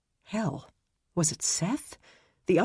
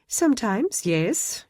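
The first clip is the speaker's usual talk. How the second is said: high, sheepish French lilt; laughing French admission; 7 semitones higher than usual. A narrator's reading. Lizette admitting she is nosy, French accent. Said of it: "Her voice carried a lilting French accent".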